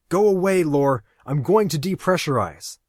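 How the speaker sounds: loud, high warning; urgent shout. Acted. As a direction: shout the danger at her. Aaron warns Lori off.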